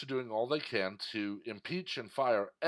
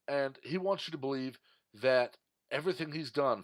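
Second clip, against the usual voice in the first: ordinary delivery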